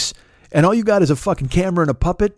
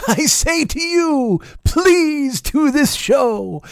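very slow, much higher, melodramatic; mock deathbed plea; 8 semitones higher, slower than usual. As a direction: beg like a dying man, hammed up. Mock deathbed plea to puppet guy.

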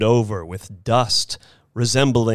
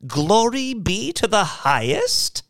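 put-on high-pitched, appalled old lady; appalled cry; 13 semitones higher than usual. A narrator's reading. Missus Bellows seeing the rocket ship, appalled. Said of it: "cried Missus Bellows, appalled".

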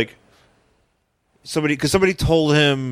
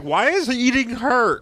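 much higher, slow puzzled question; high confused voice; 11 semitones higher than usual. Retold or imitated. Beyonder baffled at the restaurant, high slow question.

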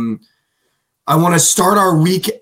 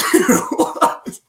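high, breathy whispered confusion; whispered disbelief; 10 semitones higher than usual. Retold or imitated. Imitating Kyle Anderson whispering his confusion. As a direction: whisper it, baffled. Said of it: "It's like".

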